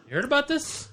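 squeaky mock echo. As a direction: echo the question in a silly squeaky voice. Mocking echo of a question.

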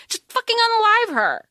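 high, heated outburst; ranting. Real, not acted. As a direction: blurt it out in a rant. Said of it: "I'm, like, ranting".

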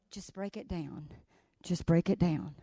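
very soft, low "break it down"; imitating girl's murmur. Granddaughter coaching herself under her breath. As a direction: mutter it to yourself. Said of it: "I see her go".

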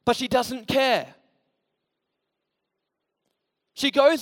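loud, high, impassioned sermon delivery; full-force preaching. Acted. Pastor stirring up the congregation, preaching loud and high. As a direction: preach it out to the back pews.

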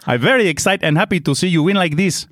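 high, put-on Italian-Spanish accent; Italian accent slipping Spanish. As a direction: gush in a wobbly attempt at Italian-accented English. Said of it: "I'm sounding Spanish now".

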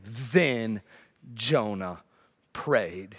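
low, weighty "Then", "Jonah", "prayed", each set apart; emphatic reading. Shown as a demonstration. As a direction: pause after "Then" and "Jonah", land low. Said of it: "use emphatic and say".

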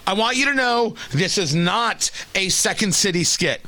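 normal talking voice

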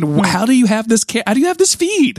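high-pitched, baffled outburst; comic exasperated squeal of disbelief; mock incredulous questioning. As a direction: fire off questions in hammed-up disbelief. Baffled quizzing of cartoon heroes.

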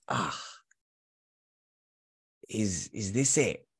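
mild, slow deflated sigh; retold letdown. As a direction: let it sink in slowly, quietly disappointed. Retold letdown on reaching dream job.

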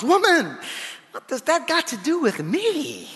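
indignant, pushing back at being asked; angry. Shown as a demonstration. Son snapping at his mother's request, angry tones. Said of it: "in kind of angry tones".

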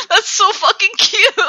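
high, loud, laughing gush; gushing squeal. Real, not acted. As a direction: squeal helplessly over something adorable. Gushing over the wife's nickname.

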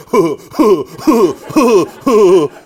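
loud huffed "huh" chuckles; mock dopey laugh. Guy in the back of the room, mock dopey laugh. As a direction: imitate a dim guy laughing in bursts.

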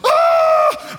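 loud, high scream of fright; panicked scream. Retold or imitated. Pastor reliving the fireball in his face, screaming. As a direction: shriek as fire hits your face. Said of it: "I was like".

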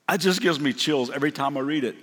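normal talking voice

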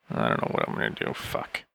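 under-the-breath frustrated whisper; muttering to himself. Real, not acted. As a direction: mutter it, barely audible.

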